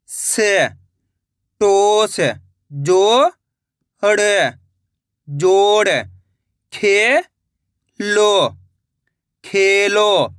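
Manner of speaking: "सो... ना... सोना": each syllable held long, then blended; long held vowels as in "खे... लो... खेलो"; syllable-by-syllable reading. Shown as a demonstration. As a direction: stretch each syllable like "जो... क... जोक", pausing between. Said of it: "अब आगे देखिए".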